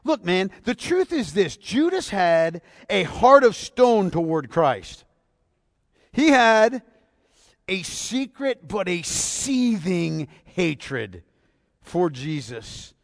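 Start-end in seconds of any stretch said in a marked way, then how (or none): 1.6-12.9 very slow, weighty, then dropping; slow ominous. Real, not acted. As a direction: drag each word out darkly. Slow ominous emphasis on Judas.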